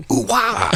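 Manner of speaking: high yelping ooh wah; high shouted mock whine; mock wail. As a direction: yelp it like a goofy noise. Mimicking someone's reply.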